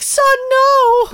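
Air Bud's mom. Acted. Air Bud's mom begs her son, in a high mock voice. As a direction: squeak it out like a fretting mother. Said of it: "Air Bud's mom was like".

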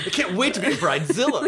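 high-pitched mock bridal excitement; eager bridezilla. Host playing along as bride, mock eager. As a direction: squeal it like a bride-to-be.

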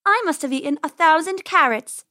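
high boastful bunny brag; bragging. A narrator's reading. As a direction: outdo your brother. Penny bragging.

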